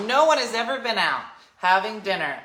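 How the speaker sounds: ordinary talk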